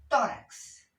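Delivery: "Tórax" with a high, loud first syllable; stressed-syllable demonstration. Shown as a demonstration. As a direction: punch "Tó" in "Tórax". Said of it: "También acá lleva el acento".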